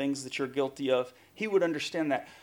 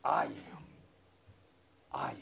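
near-whispered, spaced repetition; hushed declaration; 9 dB quieter than usual. Acted. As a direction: let each one fall almost to a whisper. Repeats Jesus's words in a hush.

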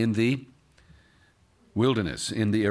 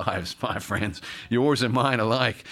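ordinary talk, nothing marked

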